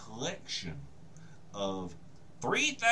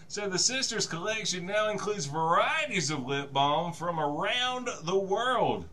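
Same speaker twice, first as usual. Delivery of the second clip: ordinary delivery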